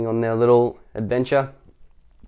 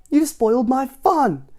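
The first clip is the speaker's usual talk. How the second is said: Mister Tickle; 14 semitones higher, 4 dB louder, slower than usual. Acted. Mister Tickle complaining, high, slow.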